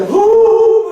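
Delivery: loud, high comic wail; mock wail. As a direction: burst into an exaggerated crying howl. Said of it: "갑자기 막 우는 거예요". (과장되게 우는 소리)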